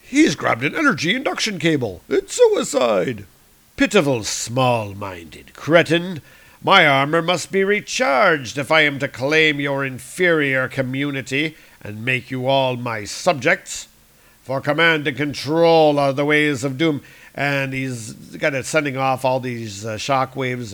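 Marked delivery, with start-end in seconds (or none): 0.0-3.2 a touch of panic, rising high; alarmed guard. Acted. Guards seeing Doom grab the cable, alarmed. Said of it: "speaking the obvious". 3.8-17.0 louder contemptuous tyrant; Doctor Doom. Acted. Doom recharging his armor, contemptuous. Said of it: "Doom speaks".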